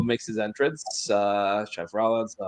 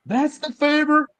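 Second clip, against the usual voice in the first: high-pitched mock disbelief; Paul Heyman; 3 dB louder than usual. Manager learning what the favor is, high imitated disbelief. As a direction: squeal the question in a put-on voice. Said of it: "Paul Heyman's face. He goes".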